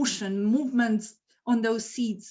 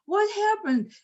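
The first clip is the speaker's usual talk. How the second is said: high, contained shriek of shock; retold scream; 9 semitones higher than usual. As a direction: cry out in mock alarm, pitched up. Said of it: "and I scream".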